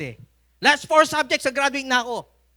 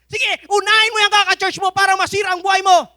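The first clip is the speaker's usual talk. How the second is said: high, loud scolding shout; angry father; 13 semitones higher, 12 dB louder than usual. Retold or imitated. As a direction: yell bitterly at the churchgoing son. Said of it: "Galit na galit po siya". (galit na sigaw ng tatay sa anak, mataas at malakas)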